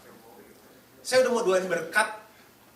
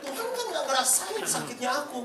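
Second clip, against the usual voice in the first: higher, laughing mock whine about being hurt; whiny complaint to God; 7 semitones higher than usual. Preacher mimicking believers sulking at God, higher mock whine. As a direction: play a sulky believer griping at God, half laughing. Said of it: "Kita gitu kan sama Tuhan".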